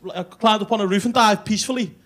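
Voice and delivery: furious shout. Retold or imitated. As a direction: scream at him in outrage. Girlfriend furious at cat news.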